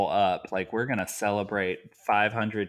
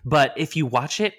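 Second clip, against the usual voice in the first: usual speaking voice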